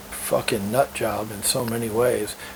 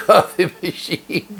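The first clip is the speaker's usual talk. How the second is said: laughing; 6 semitones higher, 5 dB louder, slower than usual. Real, not acted. Mocking her list of requests.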